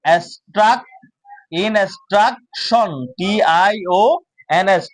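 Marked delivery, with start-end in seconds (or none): 0.1-3.1 instruction slowly as in, struk, shon; piece-by-piece instruction; broken into parts. Shown as a demonstration. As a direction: pause between the syllables of instruction. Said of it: "সেটাকে ভাগ করে করে পড়বো".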